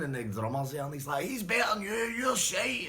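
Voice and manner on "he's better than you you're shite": high, loud taunt; taunting shout. Retold or imitated. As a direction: jeer at the real drummer. Bully taunts the drummer.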